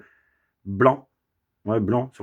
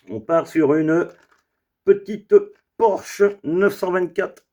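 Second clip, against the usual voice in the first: showman intro; 7 semitones higher, slower than usual. Acted. Presenting the next car.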